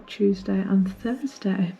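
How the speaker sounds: ordinary talk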